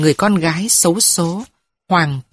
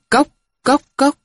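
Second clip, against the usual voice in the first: high clipped tok tok tok of a wooden bell; wooden knock imitation; 8 semitones higher than usual. Crier's wooden bell beating, high vocal knocks.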